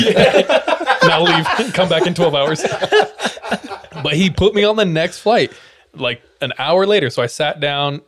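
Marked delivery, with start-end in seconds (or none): 0.0-5.5 laughing. Real, not acted. Laughs heard.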